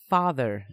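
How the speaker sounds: "father" set apart with strong emphasis; stress on father. A narrator's reading. Mother to man who burst in, heavy stress on "father". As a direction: lean hard, pointedly on "father". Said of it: "stressing the father part of that very strongly".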